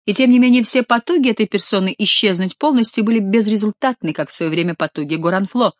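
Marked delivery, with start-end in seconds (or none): none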